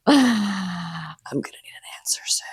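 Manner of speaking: breathy whispered aside. Acted. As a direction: lean in and whisper it.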